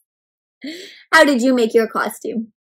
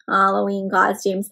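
ordinary delivery, nothing marked

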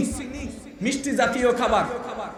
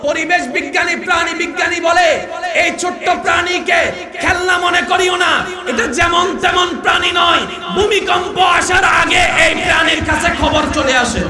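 loud, higher preacher's cry; preaching at full force; 5 semitones higher, 6 dB louder than usual. Acted. Preacher thundering to crowd about the tiny ant. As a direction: build the wonder and shout it out.

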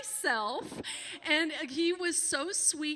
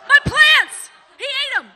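loud, high furious yell; angry shout; 12 semitones higher, 18 dB louder than usual. Retold or imitated. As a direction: bellow it as if the plants are gone. Brother shouting about his plants.

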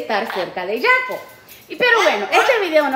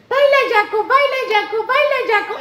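cheering pet; 8 semitones higher than usual. Real, not acted. Owner cheering her pet to dance, high singsong.

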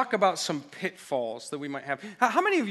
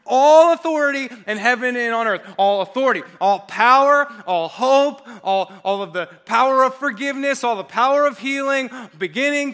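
loud, building sermon proclamation; preaching at full force; 6 semitones higher, 11 dB louder than usual. Acted. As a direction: hammer each all home to the church.

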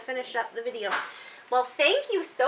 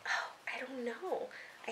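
hushed, breathy aside; whisper; 13 dB quieter than usual. Real, not acted. Parent answers child in hushed aside.

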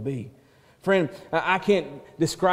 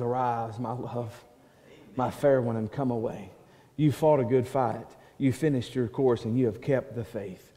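soft, hushed loving call to the bride; a soft, loving invitation; tender call; 5 semitones lower, 5 dB quieter than usual. Acted. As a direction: coax her home gently. The Lord calls his bride.

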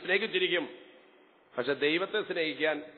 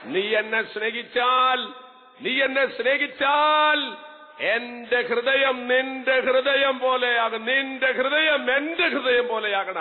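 slow, weighty, louder delivery; slow emphatic preaching; 7 semitones higher, 5 dB louder, slower than usual. Real, not acted. Preacher pressing the promise on the congregation, slow and emphatic. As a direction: lean on every phrase, slowly.

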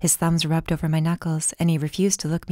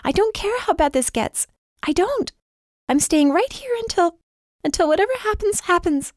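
much higher, loud, near tears; trembling tearful; 13 semitones higher, 4 dB louder than usual. Acted. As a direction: fight tears while insisting. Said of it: "My voice trembled".

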